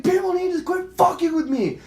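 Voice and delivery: high, fed-up re-enacted shout; retold going off. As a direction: yell it as a warning. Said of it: "I'm fucking going off".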